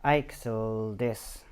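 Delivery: very slow, halting sounding-out; struggling reader. Shown as a demonstration. Dyslexic narrator showing how he reads, struggling and slow. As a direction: labour over "Ixel dis", drawing out each syllable. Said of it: "I have always struggled with reading".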